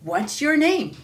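loud, high call upward; roaring. Acted. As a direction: shout up to someone far above. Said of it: "he roared".

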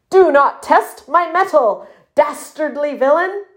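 high, bold puffin major's challenge; warning. A narrator's reading. Major Puff facing down his enemy, warning. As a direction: warn the villain off with pomp. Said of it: "warned Major Puff".

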